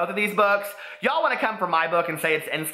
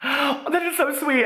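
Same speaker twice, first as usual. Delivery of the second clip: gasp, then a much higher, slow, gushing "so sweet"; delighted gasp; 11 semitones higher, slower than usual. Real, not acted. Vlogger gushing over a fan's kind message, gasping in delight. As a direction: swoon over it, melting at the compliment.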